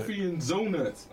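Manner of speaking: "Zonuts" with a Z replacing the D of donuts; D turned into Z. Shown as a demonstration. Woman ridiculing slang to her co-host, "Zonuts" with an exaggerated Z. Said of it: "said with so much emphasis that the D sound turns into a Z".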